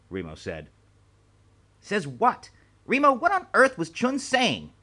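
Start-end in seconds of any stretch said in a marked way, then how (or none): 1.8-4.7 higher, louder alarmed questioning; a flustered old official; startled demand. A narrator's reading. Alarmed boss on the phone.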